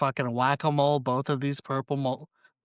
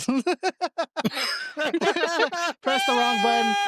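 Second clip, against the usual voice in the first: laughing; 11 semitones higher, 4 dB louder, much faster than usual. Real, not acted. Laughing joke.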